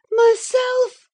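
very high, loud cry of horror; horrified high-pitched exclamation; aghast gasp. A narrator's reading. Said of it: "she gasped".

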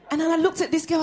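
ordinary talk, nothing marked

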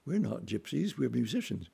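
normal talking voice

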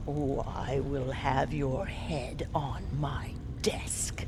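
whispered deadly threat; menacing whisper. Acted. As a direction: breathe the threat softly.

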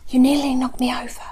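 hissed reproach. A narrator's reading. Narrator scolding friend who bumped into them, hissed. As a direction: whisper crossly at your friend. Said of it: "I hissed".

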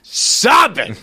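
high burst after imitated sobs; hammed-up crying squeal; mock sobbing. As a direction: act out a sobbing fit, pitch leaping up. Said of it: "I was sobbing".

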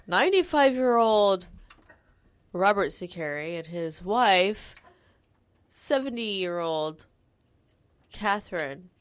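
slow, portentous announcer delivery; mock TV report. Imagining the couple on TV, mock news report. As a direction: announce it in spaced-out phrases.

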